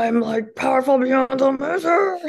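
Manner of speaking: hammed-up overpowering roar; mock powerful giant. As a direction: bellow it high like a comic brute. Mocks own big powerful self.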